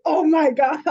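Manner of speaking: amused disbelief breaking into a laugh; laughing surprise. Retold or imitated. Retelling the horns sign, a laughing oh my gosh. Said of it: "And I'm like".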